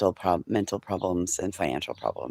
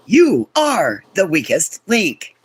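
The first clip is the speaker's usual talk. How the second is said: quiz host verdict; 10 semitones higher, 9 dB louder, slower than usual. Pretend. Quoting the game show host.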